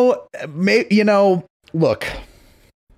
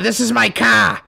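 put-on shouted Boston accent; 7 semitones higher than usual. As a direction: bellow it like a Boston brute. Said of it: "great Boston accent".

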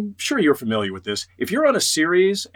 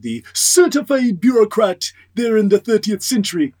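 lightly put-on Jamaican accent, higher pitch; 7 semitones higher, 3 dB louder than usual. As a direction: slip into a hint of Jamaican accent. Naming his Jamaican character Hermes.

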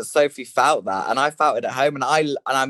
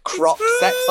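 squeaky. Real, not acted. Blurting out a cheeky idea.

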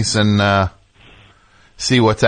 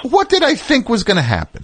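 exasperated high; 11 semitones higher than usual. Real, not acted. Exasperated rhetorical question, said twice.

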